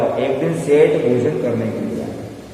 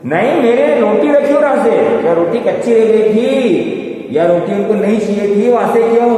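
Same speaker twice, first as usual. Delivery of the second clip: upset complaint; 8 semitones higher, 5 dB louder than usual. Acted. Wife complains to husband.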